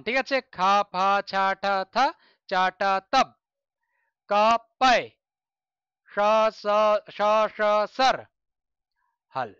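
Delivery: loud, syllable by syllable sutra reading; slow recitation. Shown as a demonstration. Teacher reciting sounds to class, slow and loud. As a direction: spell out each sound slowly for students. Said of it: "এটা তোমাদের আস্তে আস্তে পড়ে, পড়ে শোনালাম".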